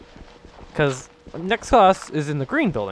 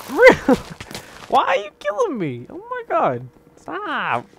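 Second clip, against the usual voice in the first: high, pleading protest at the game; real groan of disbelief at the screen; real exasperation; 12 semitones higher than usual. Real, not acted. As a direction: whine at the game for killing you. Dying in the game mid-story.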